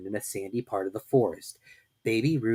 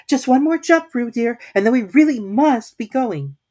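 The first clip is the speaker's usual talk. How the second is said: high, louder motherly call; a mother calling her child home; Kanga calling Roo; 14 semitones higher, 11 dB louder than usual. A narrator's reading.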